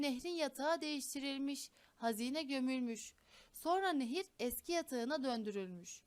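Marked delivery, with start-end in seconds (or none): none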